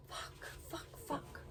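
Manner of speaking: very soft, voiceless cursing; hushed, frustrated curse under the breath; whispered swearing. Real, not acted. Swearing to self, trying to remember.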